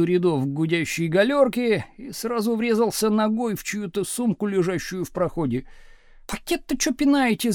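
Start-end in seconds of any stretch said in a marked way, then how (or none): 6.3-7.5 high, irritated snap; angry snap. A narrator's reading. Schoolgirl whose bag got kicked, angry snap. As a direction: snap crossly in a raised pitch. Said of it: "злобно рявкнула какая-то девица".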